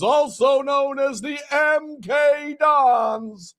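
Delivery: high carnival barker pitch; chirpy trade show pitch. Acted. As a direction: step right up, hawker style.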